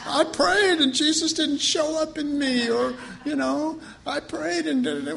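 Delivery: high, mocking voice of smug believers; mock self-praise. Preacher mocking believers who credit themselves, high and smug. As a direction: ham up their smugness.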